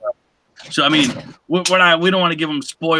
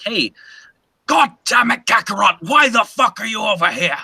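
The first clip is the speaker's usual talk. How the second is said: angry character shout; 7 semitones higher than usual. Retold or imitated. Voicing a ranting character, a high furious shout. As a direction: rage like a cartoon villain. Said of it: "it's like".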